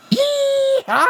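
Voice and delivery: high, loud whooping squeal; yee-haw war cry. Acted. Bobby Sue charges the killer with a loud yee-haw. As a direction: let rip a wild war whoop. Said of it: "a mighty squeal erupted".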